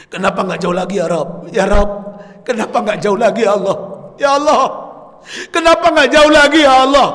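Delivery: building from a plea to a high, loud wail; a husband crying out to God as he dies. Retold or imitated. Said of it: "Suamiku berteriak, menangis".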